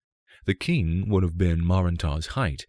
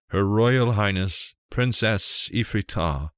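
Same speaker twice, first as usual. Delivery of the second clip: a slow, louder, higher herald call; formal announcement; 4 semitones higher, 4 dB louder than usual. Acted. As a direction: proclaim the princess with reverence. Servant announces the princess.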